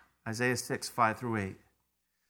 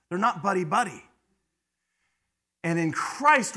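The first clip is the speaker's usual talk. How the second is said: preaching at full force; 3 semitones higher, 5 dB louder than usual. Real, not acted. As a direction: drive each word home.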